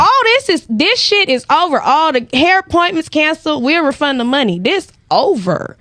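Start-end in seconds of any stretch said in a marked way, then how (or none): 0.0-4.2 high, excited declaration; thrilled triumphant exit; elated. Retold or imitated. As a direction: announce you're done for good. Repeating triumphant quitting.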